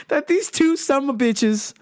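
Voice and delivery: normal talking voice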